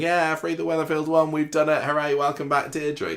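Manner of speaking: high, cheering mock celebration; mock cheering crowd. Pub regulars cheering Deirdre's return, high and mock-jubilant. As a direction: whoop it up like the whole pub. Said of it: "all the main characters are there saying".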